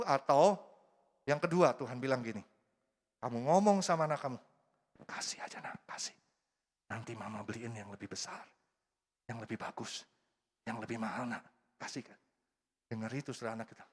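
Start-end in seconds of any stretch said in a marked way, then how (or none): 5.1-12.2 put-on whispered, coaxing the child to give it up; soft coaxing mother. Mother whispering to her own child to let the toy go. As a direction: hush the child gently into sharing.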